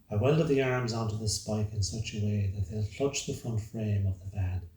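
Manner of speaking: ordinary delivery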